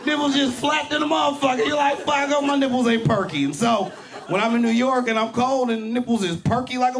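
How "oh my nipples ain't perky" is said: usual speaking voice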